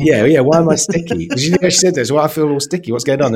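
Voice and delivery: high, loud, giggly mock-confused voice of Louise; laughing Louise impression. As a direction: play her waking up baffled and sticky, half laughing. Retelling Louise waking up sticky.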